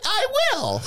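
very high, put-on voice; mock eager declaration. Mock reply to song lyric.

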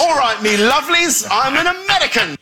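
lightly put-on American voice, high and laughing; a jokey stab at sounding American; mock American accent. Shown as a demonstration. Showing the host his American accent, laughing and high. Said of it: "That is not a preview of your American accent".

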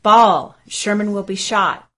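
loud burst on the name, then easing; urgent blurt. A narrator's reading. Lieutenant warns a comrade that the general is in danger, urgent blurt. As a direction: blurt out an alarmed warning, forceful first word. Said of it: "he blurted to Lieutenant".

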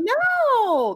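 long, high, loud wail of denial; disbelieving cry. Acted. Acts her shocked denial.

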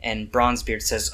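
ordinary talk

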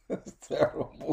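breathy laughing whisper. Real, not acted.

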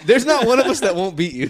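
laughing through a mock threat; laughing loud joke. Real, not acted. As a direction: giggle the words out.

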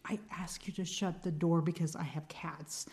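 hushed exasperated whisper. Real, not acted. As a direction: whisper it through frustration. Insisting on shutting the door.

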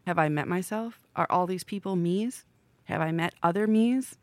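normal talking voice

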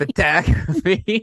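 "Photography" drawn out slow as "puh-TAG-uh-fee"; exaggerated photography. Shown as a demonstration. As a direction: repeat "Photography" slowly, hamming the "TAG". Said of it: "the way you said photography".

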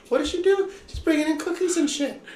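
high, exasperated complaint. Real, not acted. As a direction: throw up your hands. Exasperated about cookies.

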